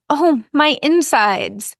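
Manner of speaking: high, slow moaning; pained moan. Acted. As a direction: groan it out like she's in pain. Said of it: "She moans".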